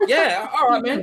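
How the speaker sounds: high, loud, chuffed; a teen thrilled to be invited round; hammed-up eager yes. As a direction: jump at the offer. Accepting invite after fight.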